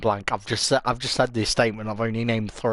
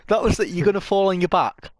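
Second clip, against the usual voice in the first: higher and louder, explaining the joke; exasperated; 9 semitones higher, 4 dB louder, faster than usual. Real, not acted. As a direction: spell out the joke in disbelief.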